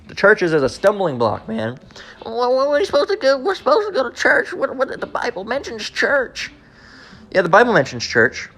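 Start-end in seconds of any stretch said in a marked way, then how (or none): 2.3-4.5 high-pitched whiny mock objection; mock protesting churchgoer. Mocks churchgoer protesting about church. 4.5-6.5 high, soft, drawn-out protest; mock churchgoer's objection. Mocks churchgoer's objection, then answers it.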